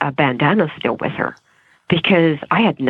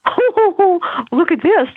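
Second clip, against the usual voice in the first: much higher, astonished; shocked surprise; 13 semitones higher, much slower than usual. Retold or imitated. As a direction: react to a shocking sight. Seeing swollen leg in morning.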